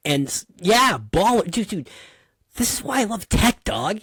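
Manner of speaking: real thrill, calling out baller and dude; excited about tech. Real, not acted. Host hears him gush over tech, real excitement. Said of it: "how can you not be excited about technology".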